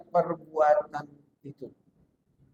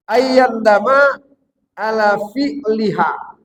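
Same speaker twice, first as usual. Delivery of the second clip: slow Arabic recitation; 4 semitones higher, 5 dB louder, slower than usual. Real, not acted. Quoting an Arabic text.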